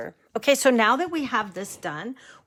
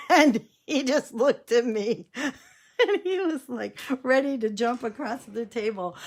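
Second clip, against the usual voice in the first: high voice breaking into laughter; laughing while telling; 5 semitones higher, 3 dB louder than usual. Real, not acted. Storyteller remembers grandpa's fury, laughing. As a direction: crack up as you recount it.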